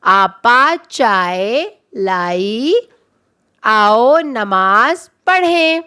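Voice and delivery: both sentences very slowly, each word apart; slow read-along. Shown as a demonstration. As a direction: lead the class through both slowly. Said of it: "ان دونوں جملوں کو ہم سب مل کے پڑھتے ہیں". (دونوں جملے بچوں کے ساتھ بہت آہستہ پڑھنا)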